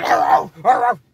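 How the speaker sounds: loud mock barks; imitated dog barking. Dog rushing the door, imitated barking. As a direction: bark like a charging dog. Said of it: "and your dog run".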